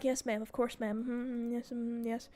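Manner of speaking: very soft, timid submission; meek yes ma'am. Retold or imitated. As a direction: cower and agree quietly. Meek staff replying to mom.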